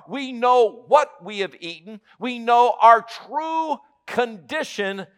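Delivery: a pastor preaching loud and high to his flock; preaching at full force. Acted. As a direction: preach it hard to the congregation. Preaching on Adam and Eve.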